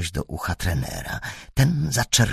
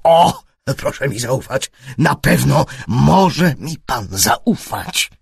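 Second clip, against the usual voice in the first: louder, higher begging; pleading servile; 5 semitones higher, 6 dB louder, slower than usual. A narrator's reading. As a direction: beg him to believe you. Trener błaga o zaufanie. (trener błagalnie, służalczo)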